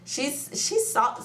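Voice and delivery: ordinary talk, nothing marked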